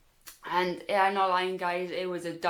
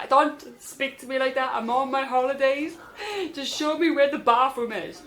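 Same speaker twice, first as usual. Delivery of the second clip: higher, louder indignant friend; retold snapping; 5 semitones higher, 4 dB louder than usual. Sick friend snapping at her travel companion, indignant. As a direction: imitate a friend telling someone off.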